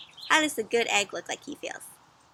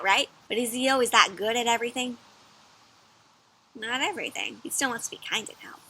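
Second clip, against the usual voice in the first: ordinary delivery, nothing marked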